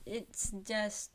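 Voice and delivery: normal talking voice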